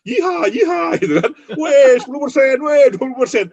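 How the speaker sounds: high, loud mock whooping; mock cheering. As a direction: cheer like the gloating winners in the group. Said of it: "tujuh ribu sembilan ratus sembilan puluh sembilan lain".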